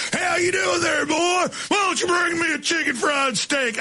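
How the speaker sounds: put-on high, hollered East Texas accent; hammed-up, high-pitched Texas twang; mock East Texas accent. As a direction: holler like a caricature East Texan. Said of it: "say, some East Texas people's restaurant".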